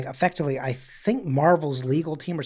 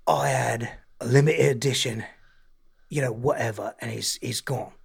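mock London accent; 4 semitones lower than usual. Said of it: "he's like".

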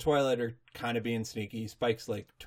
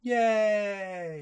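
high, drawn-out yay; playful cheer; 8 semitones higher, 3 dB louder than usual. Pretend. Co-host cheers the pony's lesson learned, playful. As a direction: cheer lightly on a long note.

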